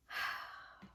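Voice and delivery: long audible breathy sigh; real sigh before going on. Real, not acted. Sighs before discussing disliked merino.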